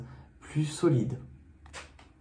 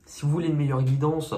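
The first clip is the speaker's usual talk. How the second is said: ordinary talk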